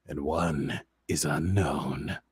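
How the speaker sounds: low, soft and slow ominous reveal; ominous hush. Acted. Recapping the mystery life sign, low ominous hush. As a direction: drop to a spooky hush.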